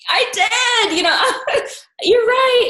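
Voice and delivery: high, loud, laughing through it; high giddy laughing agreement; laughing joy. Retold or imitated. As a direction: burst with delighted laughter.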